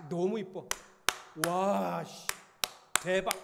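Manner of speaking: loud, exaggerated wow, big punch on each word; mocking how women gush over pretty things; overexcited gushing. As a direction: ham up a gushing reaction. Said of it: "이 정도 하지만".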